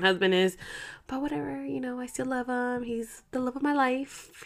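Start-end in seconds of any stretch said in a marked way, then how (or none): none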